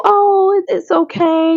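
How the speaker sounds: high, sweet mock-prissy voice; prissy cute. Vlogger mocking how she tried to stay composed, in a high prissy voice. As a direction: play it dainty and fake-sweet. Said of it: "be all prissy, be all cute".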